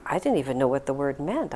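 ordinary delivery

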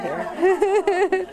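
real amused laughter in the voice; laughing. Real, not acted. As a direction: break up laughing as you say it. Joking aside, bursts into laughter.

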